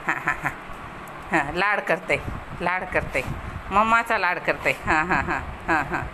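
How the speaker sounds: low, soft murmured cooing. Real, not acted. Owner croons back while her dog snuggles up, low and soft. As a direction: croon softly to a cuddling pet.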